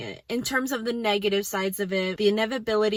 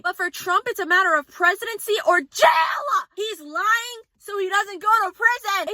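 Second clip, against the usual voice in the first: high, loud shouting rant; furious rant; 7 semitones higher, 4 dB louder than usual. Real, not acted. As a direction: shout it out in outrage. Ranting about Trump.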